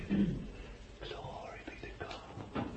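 slow breathless whisper of awe; whispered praise. Real, not acted. Preacher awed by the descending Spirit, whispering. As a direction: hush it like a prayer.

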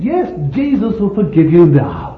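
loud, emphatic assurance; fervent preaching. Acted. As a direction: proclaim forgiveness with rushing fervour. Preaching at full force.

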